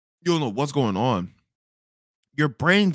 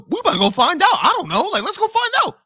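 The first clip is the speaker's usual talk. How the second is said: excited urgency; 6 dB louder than usual. Retold or imitated. Reacting to the fire alarm.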